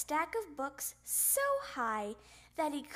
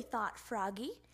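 usual speaking voice

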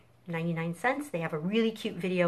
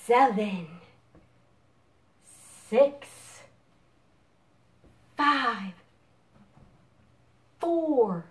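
building countdown; 7 semitones higher, 4 dB louder, much slower than usual. Real, not acted. Countdown to blast off.

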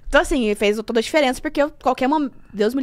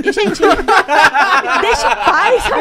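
laughing retort; 4 semitones higher, 7 dB louder, much slower than usual. Retold or imitated. Mocking people who judged her.

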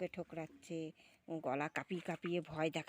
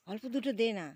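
raised, higher repeat of the request; louder urging; 4 semitones higher, 9 dB louder, faster than usual. Real, not acted. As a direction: call it out to him now. (জোরে অনুরোধ)

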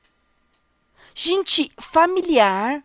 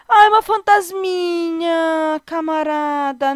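mock sob; 5 semitones higher, much slower than usual. Real, not acted. Reacting to ghost Sim.

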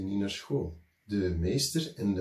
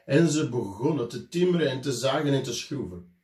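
ordinary delivery, nothing marked

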